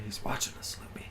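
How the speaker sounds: spooky hushed whisper. Pretend. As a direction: whisper it like a secret. Joke about a lurking viewer.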